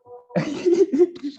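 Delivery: short high nervous giggle; nervous laugh. Real, not acted. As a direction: laugh off the nerves, high and loud. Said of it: "tuh kan gugup kan?".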